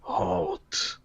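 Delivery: deep rumbling giant's voice; ultra low rumble. Acted. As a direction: rumble it like a fiery behemoth. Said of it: "a deep rumbling voice says".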